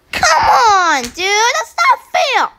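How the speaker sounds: laughing, loud and high protest; frustrated yell. Real, not acted. Gamer yelling at the game after losing, laughing. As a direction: shout it out while laughing in disbelief.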